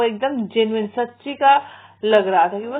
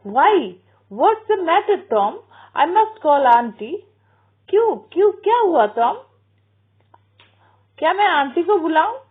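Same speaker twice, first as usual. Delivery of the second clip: put-on high, urgent alarm in a boy's voice; alarmed brother; 6 semitones higher, 4 dB louder than usual. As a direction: Sid startled and worried, pitched up. Sid alarmed by Tom's groans.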